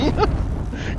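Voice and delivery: breathless squealing giggle; a silent, high wheeze of a laugh; high wheezing laugh. Real, not acted. Gamer cracking up at the hit, breathless high giggle.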